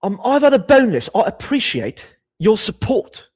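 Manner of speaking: high, hammed-up British accent. Streamer thanking a donor in their currency's accent, British accent. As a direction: put on a loud, lofty British voice. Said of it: "I'll do the accent of whatever currency".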